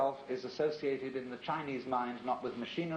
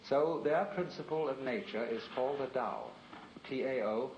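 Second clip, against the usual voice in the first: ordinary delivery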